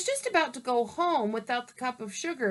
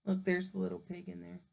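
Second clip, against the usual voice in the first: usual speaking voice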